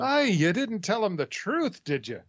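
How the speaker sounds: high, drawn-out Scottish burr as Scotty; Scottish accent. Acted. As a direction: put on Scotty's indignant Scottish brogue. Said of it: "Engineer Scott answer from that episode".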